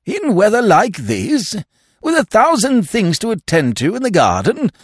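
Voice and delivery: high and pleading, still aghast; the lord protesting he cannot leave his garden; appalled protest. Acted.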